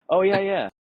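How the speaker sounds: mock breezy pilot; bluffing pilot. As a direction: fake confidence like a lost pilot. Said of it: "he was talking about Fort Walton".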